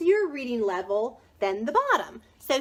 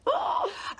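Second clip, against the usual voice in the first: sharp, high intake of breath; excited gasp; 13 semitones higher than usual. Real, not acted. Gasp after saying she joined.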